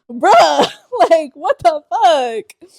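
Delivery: slow, laughing wail of disbelief; half-crying, half-laughing at the bad tea; hammed-up sorrow through laughter; mock despair laughing. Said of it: "I was so sad".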